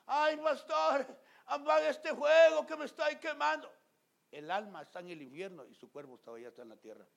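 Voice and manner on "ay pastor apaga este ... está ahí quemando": high, loud, slow wailing plea; pleading sufferer. Acted. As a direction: beg the pastor in a much higher, louder voice. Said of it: "la gente grita". (súplica aguda y fuerte de un enfermo)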